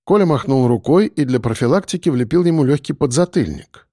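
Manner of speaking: ordinary delivery, nothing marked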